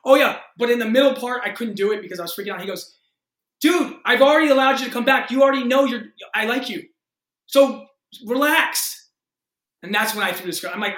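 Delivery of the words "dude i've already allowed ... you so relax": loud, high, impatient director's outburst; retold exasperation. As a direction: act out a director urging a nervous actor to relax. Acted exasperated director reassuring nervous actor.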